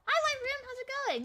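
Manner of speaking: high-pitched little hello; acted greeting. Imagined greeting in band room.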